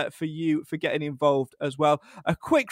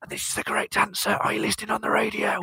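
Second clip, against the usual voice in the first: whispering; 3 semitones higher, faster than usual. Acted. Jokey aside to co-host.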